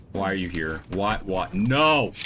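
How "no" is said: sharp raised no at the dogs; scolding no. Real, not acted. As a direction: tell off a dog, louder and higher. Scolding dogs during the stream.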